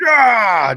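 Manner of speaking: sudden loud high "Ah!" shout; mock jump scare yell. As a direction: startle someone from the closet.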